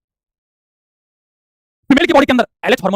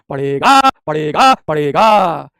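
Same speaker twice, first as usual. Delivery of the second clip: drawn-out insistence; 3 semitones lower, 4 dB louder, much slower than usual. Real, not acted. Teacher insisting meiosis is a must, drawn-out insistence. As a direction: stretch each word's ending long. Teacher driving point home.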